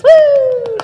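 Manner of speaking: high, loud whoop of celebration; cheering whoop. Real, not acted. Host cheers after the contestant finishes, excited whoop. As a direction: whoop for the last performer.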